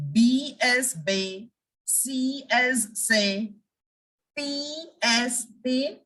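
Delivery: "bay", "say", "pay" drawn out slowly; English letter, then slow French name "bay", "say", "pay". Shown as a demonstration. Teacher recapping French letters for learners, slow and deliberate. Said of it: "we have made".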